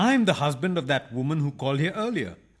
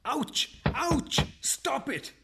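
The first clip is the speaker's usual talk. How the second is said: cries of pain; 8 semitones higher, 5 dB quieter, much slower than usual. Acted. Man hit by the knocking.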